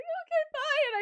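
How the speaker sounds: very high, loud squeak; hammed-up flustered bye; panicked goodbye. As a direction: squeal a hasty mock farewell.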